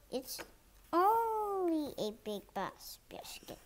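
plain reading voice

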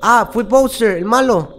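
loud, high, excited exclamation; blurting it out, thrilled; excited outburst. Real, not acted. Gamer reacting to Bowser, excited and loud.

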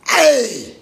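sharp loud call of hey. Real, not acted. Speaker rants to listeners, loud call.

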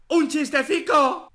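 loud eager announcement; excited. Real, not acted. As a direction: announce it with glee.

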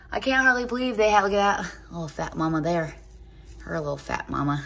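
ordinary delivery

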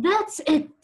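light up with the idea; sudden happy exclamation; excited cry. Acted. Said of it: "cried Missus Pena".

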